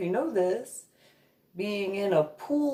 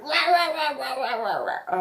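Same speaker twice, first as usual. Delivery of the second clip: mock ranting; 5 semitones higher than usual. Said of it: "the guy would just".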